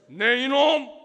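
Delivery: put-on booming scold at a naughty child; sharp pastor's roar of "네 이놈"; stern shout. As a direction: bellow it to freeze the kid. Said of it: "캐제를 부르면서".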